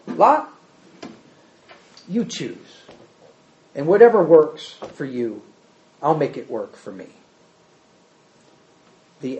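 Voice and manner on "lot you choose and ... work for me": low, measured, conciliatory voice; Abraham yielding to Lot. Acted. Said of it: "He says".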